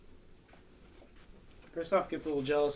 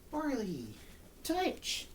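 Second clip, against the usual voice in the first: high dog call; 9 semitones higher, 3 dB quieter, much slower than usual. Real, not acted. Calling dog to do touch.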